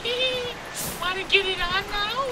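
very high falsetto come-on; mock flirty female character. Companion propositions the wolf, mock flirty falsetto. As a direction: tease in a put-on squeaky voice. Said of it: "She's like".

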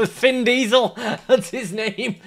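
high and slow through laughter; laughing. Real, not acted. Gamer naming his character while laughing, high and slow. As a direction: cackle out the new name.

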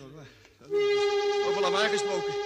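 high, slow, loud exasperation; exasperated outburst. Acted. Jimmy fed up with the noise, exasperated shout. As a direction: throw up your hands at the racket.

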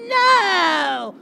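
loud crying "No!"; anguished cry. Acted. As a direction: wail it in anguish. Louder dismay at song.

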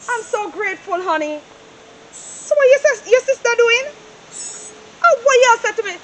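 ordinary delivery

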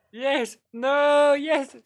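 high, loud mock insistence; escalating playful shout; playful arguing shout. Playful back-and-forth, shouting escalates.

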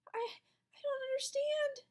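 high, slow, tearful stammer; a frightened kid close to tears; humiliated kid stammering before the room. A narrator's reading. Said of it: "red-faced and stammering".

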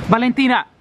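high-pitched summons; calling out. Real, not acted. Owner calling a wanderer out of the rain, high call.

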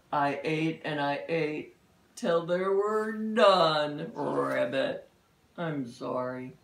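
lightly voiced remorseful toad; low toad apology; sorry toad voice. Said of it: "Grumpy Toad".

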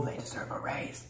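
whispered aside; whispering. Real, not acted. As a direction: whisper it like a secret. Aside about bakery staff.

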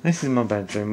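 ordinary talk

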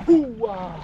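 normal talking voice

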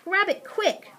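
high, urgent self-prompt; urging himself. A narrator's reading. Mister Hoppy telling himself to act, urgent. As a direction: spur yourself to act at once. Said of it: "he told himself".

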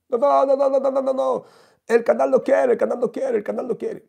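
very high, loud panicked no's; shrill refusal. Retold or imitated. Celebrity refusing the teller's photo, squealing high and loud.

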